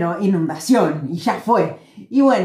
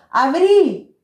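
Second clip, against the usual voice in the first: high call to open the tap; calling outside; retold shout; 7 semitones higher, 4 dB louder than usual. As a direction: shout an order. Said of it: "Y le digo".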